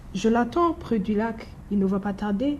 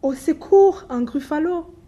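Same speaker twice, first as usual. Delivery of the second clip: high, loud fake panic; cry for help; 8 semitones higher, 7 dB louder than usual. The mouse cries for help at the monster, loud and high. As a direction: shriek for help like a cornered mouse.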